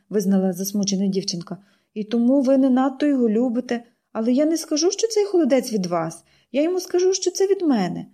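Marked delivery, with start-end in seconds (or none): 1.9-7.1 put-on higher, eager girl building her case; girl's voice rising as she pleads; girl pleading. Pollyanna explains. 7.1-8.1 put-on higher eager girl: що це від мене; from me, brightly; girlish voice on що це від мене; girl's voice. Girl says it.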